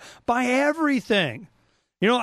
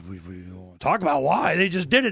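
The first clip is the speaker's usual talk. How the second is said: ordinary delivery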